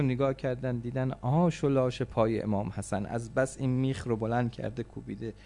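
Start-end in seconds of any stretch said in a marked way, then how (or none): none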